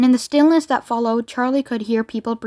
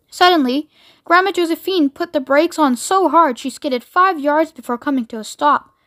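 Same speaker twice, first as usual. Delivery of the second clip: usual speaking voice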